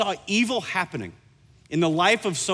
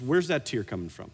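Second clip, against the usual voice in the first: gentle asking; 6 semitones lower, 6 dB quieter than usual. Shown as a demonstration.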